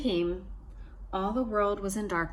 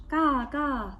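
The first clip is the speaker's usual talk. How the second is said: trickster tale voices; 6 semitones higher, 3 dB louder than usual. A narrator's reading. Baby babbling at the ball.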